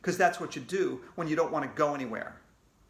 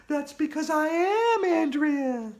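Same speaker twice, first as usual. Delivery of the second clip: high loud slow ghostly shout; triumphant shout from the ghost; 12 semitones higher, 5 dB louder than usual. Acted. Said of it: "she shouted".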